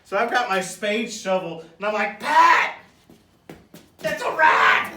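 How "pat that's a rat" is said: high loud scream of alarm. Retold or imitated. Retelling screaming at Pat about the rat, a high loud yell. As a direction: scream the warning. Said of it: "'Cause I'm screaming rat".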